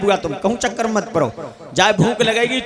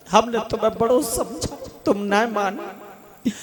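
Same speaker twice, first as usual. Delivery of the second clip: mock sob fading; 7 dB quieter than usual. Husband giving up on his wife at the station, mock whimpering. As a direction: let the fake sobbing die away. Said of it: "फपक फपक के रो रहे थे".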